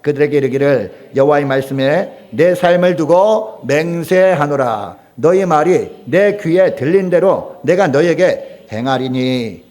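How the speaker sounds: loud, slow, measured solemn reading; scripture read aloud. Shown as a demonstration. Preacher leading everyone reading God's oath together, loud solemn recitation. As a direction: lead a congregation through the verse, slowly and forcefully. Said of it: "우리 다 같이 읽어보시면".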